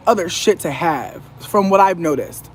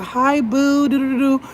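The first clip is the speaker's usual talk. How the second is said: high, sugary imitation of mom; mock sweet mom voice; 7 semitones higher, slower than usual. Daughter mocking her mom's soft phone greeting, high and sugary. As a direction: coo it like a fake-sweet mother. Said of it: "talk all soft and shit".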